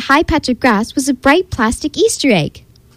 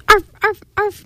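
high, eager spoken barks; three high happy dog yaps; happy barking; 9 semitones higher than usual. A narrator's reading. Marley the dog bounds into the bakery, happy high arfs. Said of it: "Marley barked happily".

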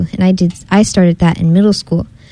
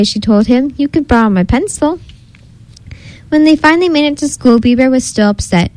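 lively storybook reading, higher than usual talk; story narration; 4 semitones higher than usual. A narrator's reading. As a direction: read a picture book to children with lifted, swooping pitch.

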